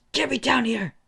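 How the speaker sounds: high, strained acted yell; angry demand. Stuck bully ordering his rival to get him down, angry. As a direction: jump up in pitch and demand it.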